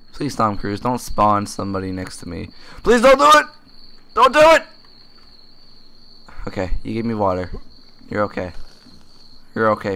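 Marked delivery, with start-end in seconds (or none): 2.8-4.6 high, loud begging; panicked plea. Real, not acted. Gamer begging the game not to spawn a zombie, panicked. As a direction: beg in a shrill rising panic.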